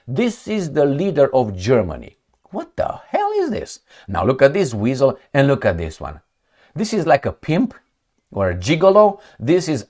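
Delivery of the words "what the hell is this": a high, shocked what the hell; incredulous. Real, not acted. Vlogger mocking a leader's photo, shrieking in disbelief. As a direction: voice jumps way up in disbelief.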